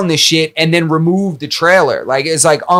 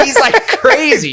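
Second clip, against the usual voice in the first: laughing; 10 semitones higher than usual. Real, not acted. Laughs recounting injury story.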